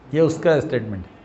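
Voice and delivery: ordinary talk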